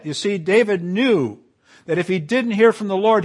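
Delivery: usual speaking voice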